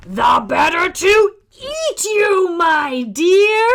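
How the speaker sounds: high roaring shout; cry. Acted. Wolf revealing himself, loud cry. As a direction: pounce on it loud. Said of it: "cried the wolf".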